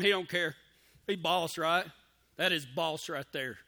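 ordinary talk, nothing marked

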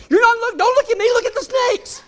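hammed-up wife snapping at her husband; exasperated wife. Wife scolds husband to watch the snakes, high mock shout. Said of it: "She's like".

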